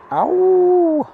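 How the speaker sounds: very high, loud held yelp; high startled yelp. Real, not acted. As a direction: yelp in sudden surprise. Startled by what camera shows.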